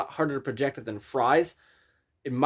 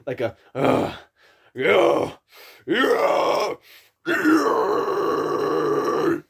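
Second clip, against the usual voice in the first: deep rasping growl; 4 semitones higher, 5 dB louder, much slower than usual. Shown as a demonstration. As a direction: growl scream rising higher. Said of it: "If you can do it perfectly three times".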